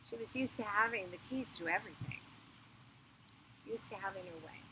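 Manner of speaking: plain reading voice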